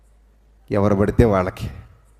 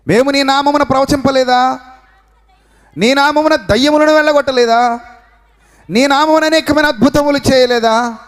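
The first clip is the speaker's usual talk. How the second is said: high, ringing pulpit reading; preacher reading the Gospel aloud to the faithful, high and ringing; fervent scripture reading; 14 semitones higher, 4 dB louder than usual. Acted. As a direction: proclaim the verse to the congregation.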